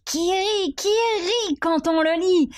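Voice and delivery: "qui rit" in a high, singsong voice; high, playful outburst. Acted. Reader acting out a book that laughs, high and playful.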